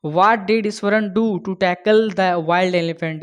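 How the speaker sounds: slow, clear read; slow question reading. Shown as a demonstration. Teacher dictating the exam question slowly.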